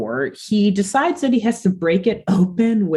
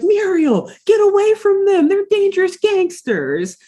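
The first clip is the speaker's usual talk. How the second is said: high-pitched mock alarm; Courage; 12 semitones higher than usual. Courage warns Muriel away from gangsters, high urgent mock panic. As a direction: imitate a panicked cartoon dog, voice way up.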